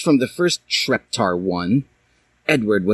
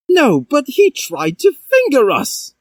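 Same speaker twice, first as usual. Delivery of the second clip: much higher, louder protest; Draco protesting; 4 dB louder than usual. A narrator's reading. Draco objecting to the professor, high and loud. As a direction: cry out in protest. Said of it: "said Draco".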